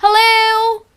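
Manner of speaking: mock screaming hello. Shown as a demonstration. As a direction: yell hello into a bad phone line. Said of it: "screaming on top of your lungs".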